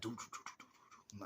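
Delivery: soft, rapid whispered knocks; quiet "do-do-do" knock noise, fading away; imitated door knocking. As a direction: imitate knocking under your breath. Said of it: "she was knocking on the door".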